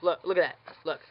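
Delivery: ordinary delivery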